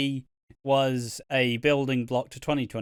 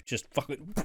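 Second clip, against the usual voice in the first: ordinary delivery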